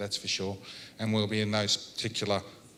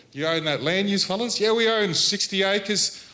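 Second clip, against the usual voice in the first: retold exchange; 8 semitones higher, 5 dB louder than usual. Retold questioning of landowners.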